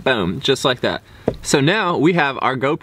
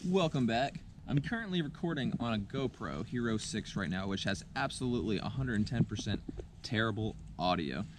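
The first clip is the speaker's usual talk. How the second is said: dull, muffled mic sound; 16 dB quieter than usual. Real, not acted. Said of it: "That's why I sound so muffled".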